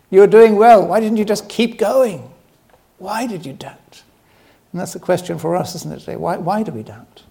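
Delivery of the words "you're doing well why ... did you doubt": higher, brighter, encouraging not berating. Retold or imitated. Jesus encouraging Peter after he sank, not scolding. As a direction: lift him up as you ask. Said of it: "He's wanting to encourage him".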